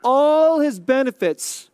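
"All" drawn out slowly; long, emphatic "All" at the start; stress on "All". Shown as a demonstration. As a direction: stretch "All" so it outweighs the other words. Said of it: "I wanna emphasize the word all".

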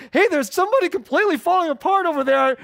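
a hammed-up startled churchgoer calling attention; mock alarm. Churchgoer at the back, mock alarm at someone crying.

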